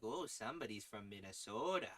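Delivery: light nasal Minnesota voice; a hint of Minnesota sing-song; Minnesota accent. Shown as a demonstration. Showing how to get across a Minnesota accent. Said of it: "this is how you get across".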